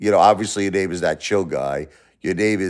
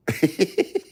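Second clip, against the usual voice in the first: mocking laugh; 8 semitones higher, 5 dB quieter than usual. Real, not acted. Laughs after telling troll off.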